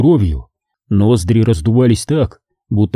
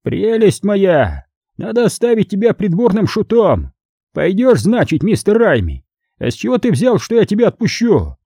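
amused cardinal; 8 semitones higher than usual. Acted. Cardinal mocking his captive as a court jester, amused, high-voiced. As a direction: tease as the cardinal, pitched far up. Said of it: "Кардинал разразился взрывом хохота".

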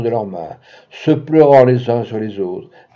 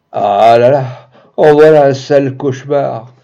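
relieved exclamation; 8 dB louder than usual. A narrator's reading. Waking from nightmare, exclaiming.

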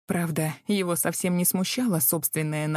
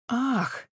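high fake gasp of innocence; coy gasp; 6 semitones higher than usual. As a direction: gasp with put-on surprise. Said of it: "сделала вид поневиннее".

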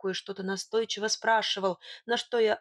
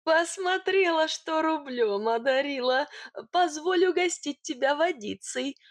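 high, gushing lovestruck character voice; besotted sweet-talker; tender cooing; 5 semitones higher than usual. A narrator's reading. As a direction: coo sweet compliments in a raised little voice. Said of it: "непривычно нежным голоском заворковал он".

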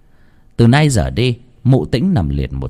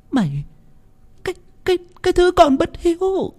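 feeble rasp; faster than usual. Acted. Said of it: "thều thào".

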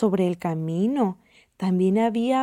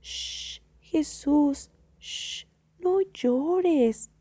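high, soft, slow hushing; little girl consoling; 5 semitones higher, 3 dB quieter, much slower than usual. A narrator's reading. Little girl sees Jesus' tear, soothing. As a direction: hush tenderly in a child's high voice. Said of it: "una niñita ve".

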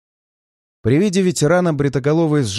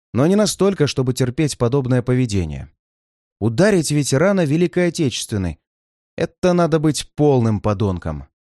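usual speaking voice

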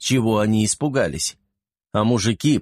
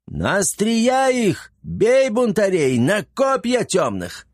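much higher, slow, roaring battle call; rally the troops at full voice; booming war cry; 10 semitones higher, slower than usual. A narrator's reading. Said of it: "зычно заорал". (зычный боевой клич)

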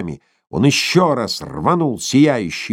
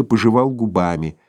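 usual speaking voice

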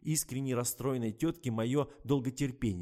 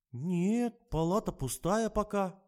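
bleated; 9 semitones higher, 5 dB louder, much slower than usual. Acted. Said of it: "проблеяла медсестра".

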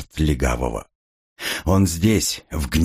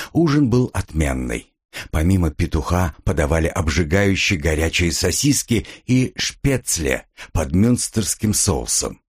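ordinary delivery, nothing marked

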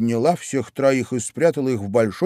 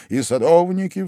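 usual speaking voice

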